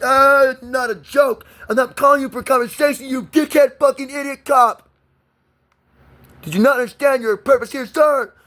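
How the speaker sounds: high-pitched, outraged telling-off; furious rant. Real, not acted. Caller berating a cop over the Constitution, furious high-pitched rant. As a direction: berate the officer at the top of your pitch range.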